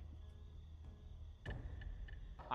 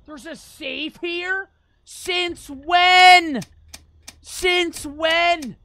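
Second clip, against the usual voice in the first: shocked disbelief; 8 semitones higher, 13 dB louder, much slower than usual. Real, not acted. Finds unexpected safe in game.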